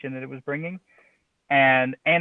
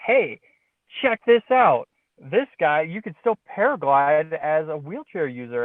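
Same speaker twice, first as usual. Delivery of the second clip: excited, much higher-pitched call to come look; all pumped; 4 semitones higher, 4 dB louder, slower than usual. Retold or imitated. Pilot showing his dad a paragliding discovery, all pumped. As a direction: retell it bursting with eager discovery. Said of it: "I'm, I'm all pumped".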